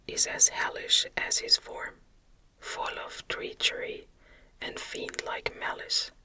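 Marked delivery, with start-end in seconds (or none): none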